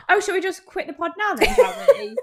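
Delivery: high, loud, laughing; laughing tease. Real, not acted. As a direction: tease her through laughter. Laughing teasing.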